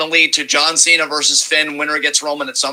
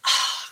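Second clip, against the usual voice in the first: breathy overwhelmed sigh; 7 dB quieter than usual. Real, not acted. Overwhelmed before a long point.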